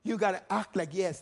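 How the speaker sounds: normal talking voice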